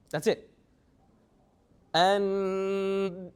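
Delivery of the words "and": "And" drawn out into a long nasal hum; nasal sound held. Shown as a demonstration. Teacher showing where the sound comes from, held nasal hum. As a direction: hold the "n" of "And" in the nose, then stop. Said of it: "the sound stops".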